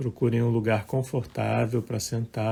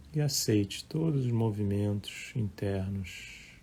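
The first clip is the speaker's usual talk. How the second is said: calm, measured meditation voice with long pauses; guided meditation; 9 dB quieter than usual. Acted. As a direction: guide listeners gently through breath and body, softer than chatting. Leads a live guided meditation. (voz calma de meditação guiada)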